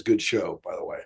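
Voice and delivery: low, soft divine voice; a hint of a deep God voice; God's voice. God speaking, retold.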